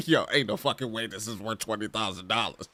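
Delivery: scoffing through a laugh; laughing disbelief. Retold or imitated. Shopper seeing a twenty thousand dollar multi-tap, laughing disbelief. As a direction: laugh off the price tag. Shocked at store price.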